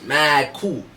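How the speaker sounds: ordinary talk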